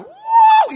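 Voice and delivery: loud, high "whoa!"; crowd cheer. Acted. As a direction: whoop like fans on the winning side. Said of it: "they're going".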